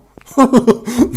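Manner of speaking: loud nervous laugh after a scare; startled laugh. Real, not acted. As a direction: burst out laughing from fright.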